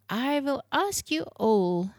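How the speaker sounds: put-on solemn request in a Germanic accent, slowly; sober Swiss-German accent. A narrator's reading. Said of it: "said Frau Helga soberly".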